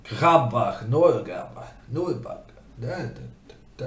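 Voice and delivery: mock Danish gibberish, hammed up; fake Danish. Norwegian streamer jokingly faking Danish for chat. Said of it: "when Norwegians try to fake Danish".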